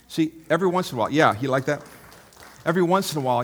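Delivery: loud playful razz at critics, fast; mock raspberry. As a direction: blow a raspberry at your critics. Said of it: "do this to your critics".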